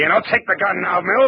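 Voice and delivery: high, strained effort while grappling; words squeezed out mid-fight; straining struggle. Acted. Marlowe wrestles the gun from Mills, straining.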